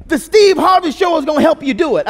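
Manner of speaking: high, loud proclamation as dad; imitated dad yelling. Dad telling his kids Steve Harvey will help, yelling. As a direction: announce the plan at a holler. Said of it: "he starts yelling at us".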